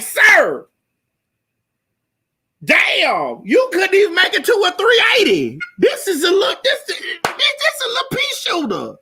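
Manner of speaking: shrill-high disbelieving outburst; high mock outrage. Woman mocking her lover's small size. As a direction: squeal with disgust and laughter.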